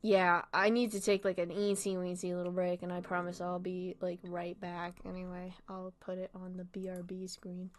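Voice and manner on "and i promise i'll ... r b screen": soft whispered aside; whispered note to yourself before stepping away. Real, not acted. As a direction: whisper it under your breath. Muttering aside before break.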